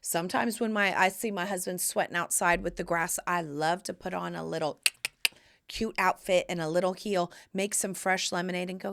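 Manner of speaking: ordinary talk, nothing marked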